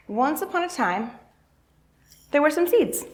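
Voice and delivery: bright fairy-tale start; storybook opening. Acted. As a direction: open the tale like reading to kids.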